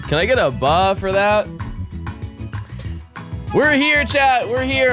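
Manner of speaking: usual speaking voice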